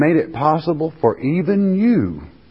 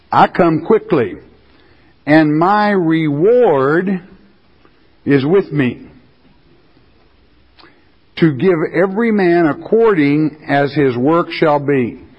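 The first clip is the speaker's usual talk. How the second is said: scripture reading; 4 semitones higher than usual. Acted. Preacher reading Revelation to congregation, solemn proclaiming.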